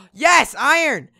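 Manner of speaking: loud, high triumphant cheering; excited yelling. Real, not acted. Gamer telling viewers they found iron, excited shout. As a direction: celebrate the find at full voice.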